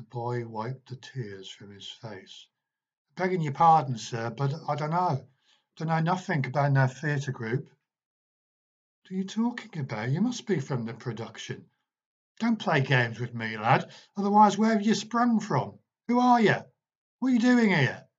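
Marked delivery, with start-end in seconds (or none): 3.2-7.7 higher, louder working-class London lad; a poor Victorian boy answering sir; timid cockney boy. A narrator's reading. Ghost boy with cockney dialect. 9.0-18.1 higher, louder, rapid furious questioning; demanding answers from a stubborn lad; angry teacher. A narrator's reading. Marley angrily questioning boy.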